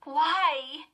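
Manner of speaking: high, loud, baffled question; sputtered protest. A narrator's reading. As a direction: blurt it out in upset bewilderment. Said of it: "she sputtered".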